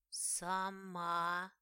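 faint rustling voice; rustling whisper. Acted. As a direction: rustle it like dry leaves. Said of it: "явственно прошелестела Хуфия".

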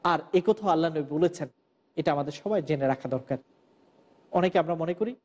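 ordinary talk, nothing marked

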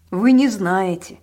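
slow and loud; emphatic repeat. A narrator's reading. Policeman realising they have not heard, slow loud emphasis. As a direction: say it slowly, with force. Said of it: "повторил он".

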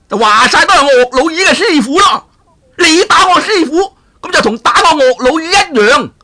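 very high, loud blustering ruffian shout; brawling villain rant. Acted. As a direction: rage and bluster like a hot-headed brute. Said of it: "呱呱嘈啦". (南海鱷神大嚷大叫，聲調極高又大聲)

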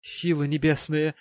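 a touch of alarm as the lady exclaims; exclaiming lady. A narrator's reading. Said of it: "воскликнула эта дама".

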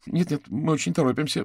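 high, loud, hurried refusal; hurried speech. A narrator's reading. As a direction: brush them off in a hurry. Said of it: "быстро проговорила она". (торопливый, встревоженный отказ)

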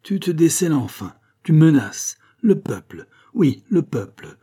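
acted triumph, high and emphatic; triumphant cry. A narrator's reading. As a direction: exclaim as if catching out a culprit. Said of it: "s'écria l'abbé triomphant".

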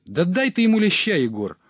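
very high, loud urging; goading shout. Acted. Reveller goads Егор to hit his son, loud shout. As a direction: yell to egg him on.

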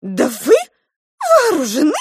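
high, squeaky alarm; little mouse aghast, pitch leaping up; gasping mouse. A narrator's reading. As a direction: squeal it in startled amazement. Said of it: "ахнула мышь".

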